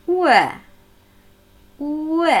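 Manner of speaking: "Ü" as a glide from u into ö; quick vowel glide. Shown as a demonstration. Teacher showing learners the glide, quick u-to-ö on "Ü". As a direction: slide off the u straight into ö on "Ü". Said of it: "schnell zu Ü und sofort zu Ö gleiten".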